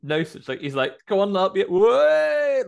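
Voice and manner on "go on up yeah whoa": loud, high-pitched mock holler; mock shouting. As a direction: imitate a daft mate yelling out. Imitates the mate shouting on.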